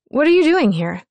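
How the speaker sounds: high, loud startled blurt; blurting out before she can stop herself; blurted in surprise. A narrator's reading. Startled narrator runs into her ex in the aisle, blurts the question. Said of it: "The words tumble from my mouth".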